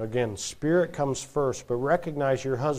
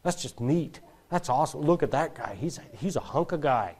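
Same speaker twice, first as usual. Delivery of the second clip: softer, dreamy admiring murmur; a hint of swooning girls; 2 semitones lower, 6 dB quieter than usual. Imagined girls admiring the boy, softly. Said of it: "you're hearing them say".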